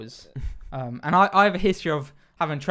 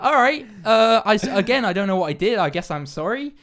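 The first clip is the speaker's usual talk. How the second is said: raised-pitch retold placating, settling toward the end; bewildered apology; 6 semitones higher, 6 dB louder than usual. Baffled passenger placates angry driver, bewildered apology. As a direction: imitate a baffled, reluctant apology.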